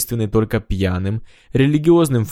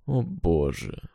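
low, soft, resigned exclamation; weary exclamation; 4 semitones lower, 7 dB quieter, much slower than usual. Acted. Reggie despairs of tonight's events, low and weary. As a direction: say it under the weight of the evening. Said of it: "повторил Реджи".

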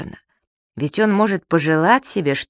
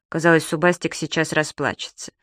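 ordinary delivery

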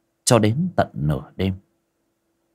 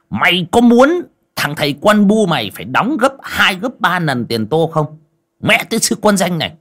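put-on an old master's scolding rant, loud and higher; angry master; 6 semitones higher, 5 dB louder than usual. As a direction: berate the servant furiously. (ông chủ quát mắng người hầu giận dữ)

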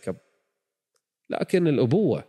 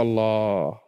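ordinary delivery

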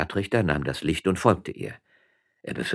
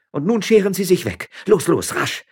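loud, high-pitched order to leave; angry dismissal; 14 semitones higher, 11 dB louder than usual. A narrator's reading. Armed woman orders the magistrate out, angry shouted dismissal. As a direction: drive him out with urgent shouted commands.

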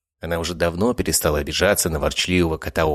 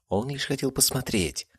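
plain reading voice

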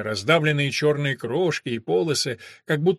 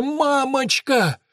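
slow, much higher hoarse wail; hoarse pleading; 9 semitones higher, much slower than usual. Acted. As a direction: drag it out, desperate and hoarse. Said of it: "прохрипел Дэн".

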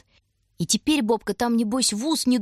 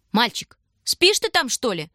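high loud slow call; stern shout; 5 semitones higher, 4 dB louder than usual. A narrator's reading. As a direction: yell sternly across the street. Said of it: "крикнула та, что построже". (строгий окрик)

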